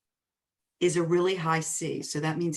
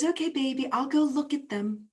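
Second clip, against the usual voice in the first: breezy sweet agreement; 7 semitones higher than usual. Retold or imitated. High driver agreeing with husband.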